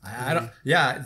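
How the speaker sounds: normal talking voice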